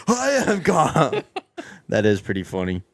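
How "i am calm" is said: mock yell. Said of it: "yelling at somebody".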